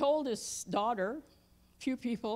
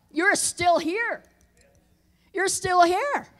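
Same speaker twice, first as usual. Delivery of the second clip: loud, high, driving sermon delivery; preaching at full force; 6 semitones higher, 8 dB louder than usual. Acted. Preacher rousing the church about living old, loud fiery preaching. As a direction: hammer it home to the congregation.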